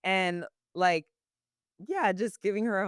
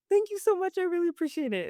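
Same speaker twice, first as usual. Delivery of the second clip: gushing thanks; 12 semitones higher, faster than usual. Retold or imitated. Grateful customer quoted.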